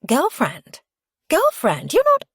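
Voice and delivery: loud, high-pitched disbelief; shrill young woman's gleeful shock; incredulous squeal. A narrator's reading. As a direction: repeat it in mounting astonishment. Squealing visitor hears shocking news.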